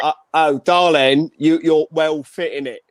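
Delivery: loud, hammed-up British chat-up accent; flirty accent on request. Shown as a demonstration. Co-host showing how he'd flirt, loud playful accent. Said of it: "Put it in an accent".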